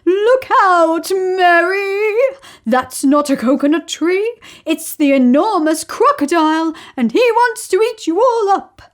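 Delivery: high, loud hippo shouting a warning; urgent warning cry. Acted. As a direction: shout them away from danger. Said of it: "cried Humpy Rumpy".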